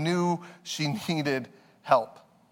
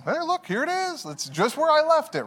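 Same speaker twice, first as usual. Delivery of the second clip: put-on toddler voice; 10 semitones higher than usual. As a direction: play his toddler spotting the toy. Voicing toddler finding toy.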